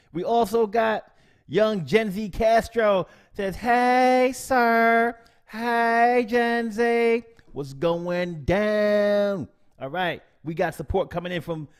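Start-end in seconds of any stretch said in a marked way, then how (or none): 3.5-9.5 loud, high hyped-up greeting, stretching the last line slow. Retold or imitated. Streamer reading a viewer's greeting to chat, loud and high. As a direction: shout the viewer's hello like a hype man.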